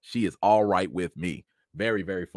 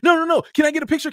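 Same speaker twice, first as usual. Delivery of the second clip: much higher, rushed pleading; begging fans; 5 dB louder, much faster than usual. Retold or imitated. Fans begging Kieran Culkin for a photo, rushed pleading. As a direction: whine like pushy fans grabbing him.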